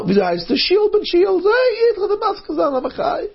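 very high, excited story voice; excited character. Acted. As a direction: call it out way up high.

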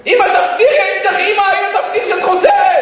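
high, insistent begging; pleading cry. Retold or imitated. Son begging mother to promise return, pleading cry. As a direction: beg at the top of the voice range. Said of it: "ושמעת אותך צועק".

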